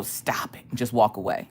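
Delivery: barely voiced, whispered command; hushed scolding. Streamer coaching a viewer to refuse, whispered. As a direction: whisper it, very softly.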